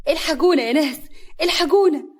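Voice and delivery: high, loud alarm cry; panicked call for help. A narrator's reading. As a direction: call the whole village to help. Stranger cries for help. (استغاثة بصوت عالي)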